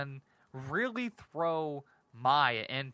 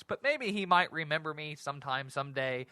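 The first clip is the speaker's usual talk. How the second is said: normal talking voice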